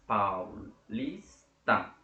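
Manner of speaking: "Paulista" in three slow, separate syllables; split into syllables. Shown as a demonstration. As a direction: break "Paulista" up as pau, lis, ta. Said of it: "eu tenho três sílabas".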